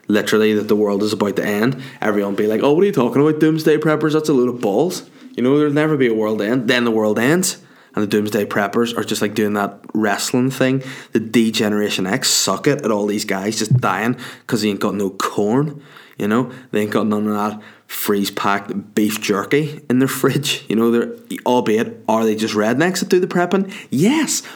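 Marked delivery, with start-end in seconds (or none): 2.6-6.7 higher-pitched voice of the doubters; imitating naysayers, pitched up; mock scoffing. Voicing scoffers of doomsday preppers. 23.9-24.4 high rising yes; much higher, drawn-up answer; emphatic concession. Real, not acted. Answering his own rhetorical question.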